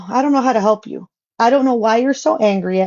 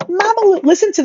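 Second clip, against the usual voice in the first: mocking kid voice; 9 semitones higher, faster than usual. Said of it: "like a little ass kid".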